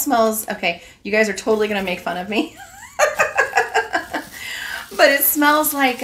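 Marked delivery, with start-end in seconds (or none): 2.6-4.9 high giggling laugh; embarrassed laugh. Real, not acted. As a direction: giggle sheepishly.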